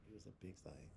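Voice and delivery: hushed whisper of the punchline. Shown as a demonstration. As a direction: drop to a secret whisper.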